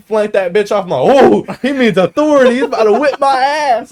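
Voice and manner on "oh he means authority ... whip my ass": high, loud panicked realization; comic alarm. Retold or imitated. Son seeing dad's belt come off, comic alarm. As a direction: blurt it in mock panic.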